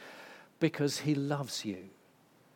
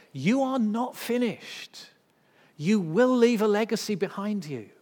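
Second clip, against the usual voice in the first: louder, higher, slowing on the key words; full-force preaching; 4 semitones higher, 7 dB louder than usual. Acted. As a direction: proclaim it over the congregation. Preaching God's words to Elijah.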